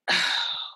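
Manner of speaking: heavy wordless exhale; long breathy sigh of defeat; exasperated sigh. Retold or imitated. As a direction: breathe out in speechless frustration. Wordless reply to friend's answer.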